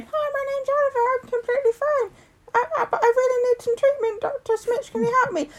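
squeaky pretend Oliver on the phone; mock child voice. Mother hands the phone to her son, mock child voice. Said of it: "I'll just put him on the phone".

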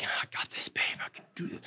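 hushed whisper to his wife. Retold or imitated. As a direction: whisper it, keeping it to himself. Dad taking on screaming baby alone.

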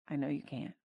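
a gentle God softly soothing the weary believer; soft reply. Acted. Softer repeat of Jesus' reply.

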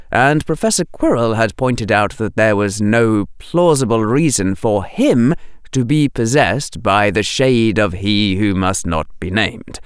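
plain reading voice

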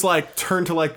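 ordinary delivery

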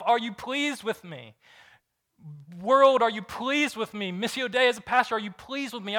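voicing a pastor desperate to please, pleading; anxious approval-seeker. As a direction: beg the world for approval. Voicing a striver craving approval.

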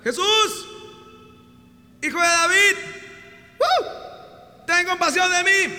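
high, loud call for mercy; blind man crying out. Acted. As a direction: shout it across the crowd, pleading. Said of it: "Y empezó a gritar".

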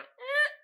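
very high little cat "eh"; imitated cat noise. Host shows how he read it with the cat, high cat noise. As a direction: squeak it like a cat. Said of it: "with the cat going".

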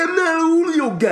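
put-on high, loud Brooklyn tough-guy accent; hammed-up Brooklyn accent. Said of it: "with a Brooklyn accent".

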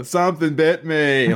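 slow, drawn-out imitation of a film line; movie quote. Host quotes Forrest Gump after a bug bite, slowly imitated. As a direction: quote it in a put-on character voice. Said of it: "I immediately go".

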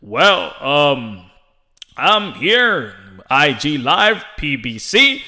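low, drawn-out announcer voice; formal mock host introduction, slowed down; Ray Flores. Announcer going live with a boxer, announcer voice. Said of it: "always in an announcing voice".

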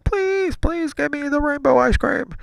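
put-on very high, whining child plea; mock-sobby, high-pitched pleading; imitated tearful begging. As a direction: beg like a crying little kid. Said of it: "And I started crying".

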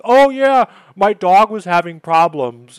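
high, loud, sing-song mock of a flimsy excuse. Mimicking the flaky friend's excuse to the cohost, high and loud mockery.

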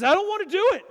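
high, loud petulant no; whiny refusal. Retold or imitated. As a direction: whine it like a stubborn child refusing. Moses flatly refusing God.

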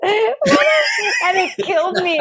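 high, slow words through big laughs; cracking up mid-story, voice shooting up; real helpless laughter. Real, not acted. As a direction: barely get it out for laughing. Laughing while recalling her wig.

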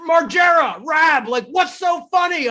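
a high mock shout from the teacher; angry teacher. Teacher calling from the other room, a high mock shout. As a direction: bark it like a fed-up teacher. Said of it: "teacher's like".

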